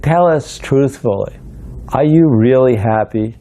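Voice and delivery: imitating an insistent young questioner; earnest questioning. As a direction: press slowly for an honest answer. Boy questions swami in retold story.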